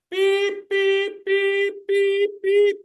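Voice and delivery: high piping beep beep imitation; high mock warning beeps; reversing truck beeps. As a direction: mimic a forklift backing up. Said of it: "like at a construction site".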